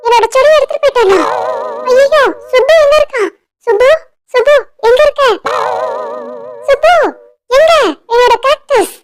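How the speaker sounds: high, alarmed calls for someone; frantic calling. Acted. Lily hunts for Subbu and her cactus, frantic. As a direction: cry out in rising panic, searching.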